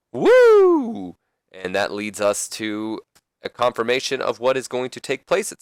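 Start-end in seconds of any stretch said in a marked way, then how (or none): 0.1-1.2 very high, loud, long woo; Ric Flair. Acted. Manager's signature send-off, long high-pitched whoop. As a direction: let out a showman's sustained whoop. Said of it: "Ric Flair says".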